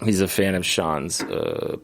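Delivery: ordinary talk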